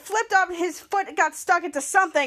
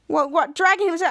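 plain reading voice

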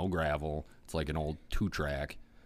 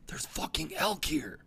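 retold whisper; 11 semitones higher than usual. Spotting elk, hushed.

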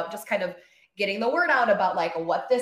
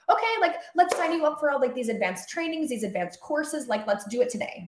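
eager bosses; 6 semitones higher than usual. Retold or imitated. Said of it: "they're like".

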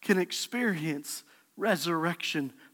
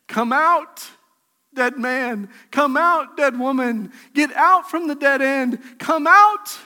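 rising shouted command, much higher and louder; loud call; 5 semitones higher, 7 dB louder than usual. Acted. Jesus calling the congregation from the grave, shouting. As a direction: cry out to raise the dead. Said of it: "The same voice that called out to Lazarus".

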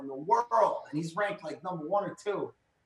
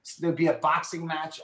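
ordinary talk, nothing marked

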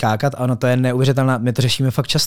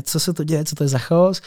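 higher, softer retold bewilderment; bewildered; 4 semitones higher, 4 dB quieter than usual. DJ to technician who stops the set, bewildered. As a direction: re-enact being caught off guard by the chaos.

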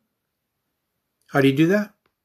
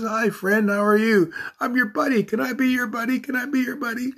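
much higher, silly friendly cartoon critter; cute spider voice; 10 semitones higher than usual. Imagined cross-eyed dancing spider greeting you, high cartoon voice. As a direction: squeak it out like a goofy little spider. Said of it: "It says".

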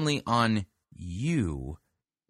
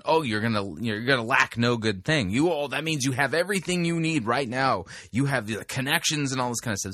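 Joel Osteen; 3 semitones higher than usual. Retold or imitated. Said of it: "he put the emphasis on".

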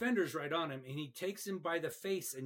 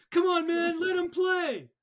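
much higher, louder, slow sideline yell; coach yelling; 12 dB louder than usual. Retold or imitated. Coach on the sideline yells at the referee, loud and high. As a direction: bellow across the field at the ref. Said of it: "It's my favorite".

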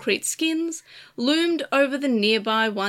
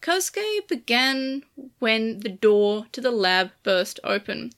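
plain reading voice

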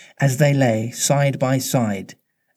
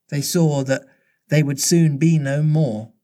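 plain reading voice

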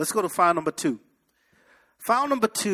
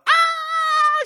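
long, high-pitched mock scream; loud hammed-up shriek of shock; 6 dB louder than usual. Talk-show woman told he is not the father, mock screaming.

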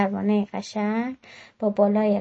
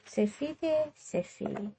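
softer and softer, almost breathed; fading echo; 10 dB quieter, slower than usual. A narrator's reading. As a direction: let the word fade away into snow. Echoing the word softly.

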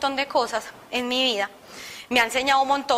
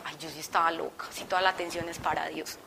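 quiet inner thought; 5 semitones lower, 7 dB quieter than usual. Retold or imitated. Recalling her own thought.

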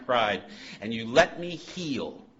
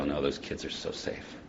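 hushed aside; 8 semitones lower, 9 dB quieter than usual. Retold or imitated. Tender aside about sober parents.

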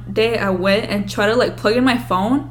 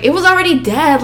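high, loud mock protest; defensive sister; 7 semitones higher, 6 dB louder than usual. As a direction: argue back like an annoyed sister. Sister defends her prank.

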